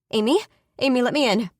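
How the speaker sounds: loud, urgent call through a door. Acted. Loud urgent call. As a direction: sister pounding on the door, shouting to be let in. Sister banging on door, calling.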